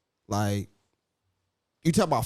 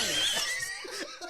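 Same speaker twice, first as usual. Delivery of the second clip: high squealing laugh burst; shrieking laugh; 8 dB quieter than usual. Real, not acted. Laughing fit at joke.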